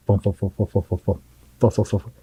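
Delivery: rapid muffled thumping gun noise; imitated machine-gun fire. Veteran mimicking continuous gunfire around him, imitated thumping. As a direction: puff out the thumps like belt-fed fire. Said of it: "feels like a continuous Hollywood belt".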